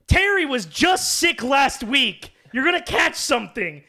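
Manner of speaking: high, loud, drawn-out fussing; scolding mom. Retold or imitated. Friend's mom warning about catching sickness, scolding.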